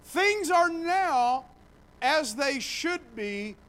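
high, loud and slow proclamation; proclaimed. Shown as a demonstration. Preacher proclaiming shalom to the church, loud and slow. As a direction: announce it triumphantly, slowly. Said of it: "repeated proclamation".

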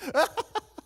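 high, put-on burst of laughter; mock laughing fit; imitated friend's laugh. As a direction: mimic a friend cracking up on the phone. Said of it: "my friend starts laughing".

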